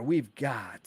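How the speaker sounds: normal talking voice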